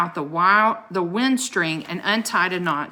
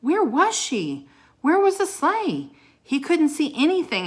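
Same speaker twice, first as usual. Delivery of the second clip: worried; 9 semitones higher, 3 dB louder than usual. Acted. Lost in the storm.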